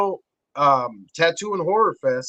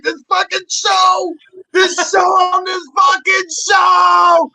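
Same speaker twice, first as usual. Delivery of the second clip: high, slow shouted mock hysteria; retold obsessed raving; 13 semitones higher, 5 dB louder, slower than usual. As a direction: stretch it out in an over-the-top high shout. Answers wife, obsessed with show.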